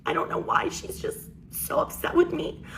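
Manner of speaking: high, whispery fake whimper; soft, breathy, put-on tearful voice; mock crying. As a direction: imitate a sister turning on the tears. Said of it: "she starts crying".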